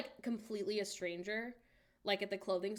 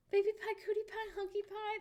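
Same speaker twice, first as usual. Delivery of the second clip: much higher, sing-song pet names; cutesy pet names; 12 semitones higher than usual. Real, not acted. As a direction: coo the names up high.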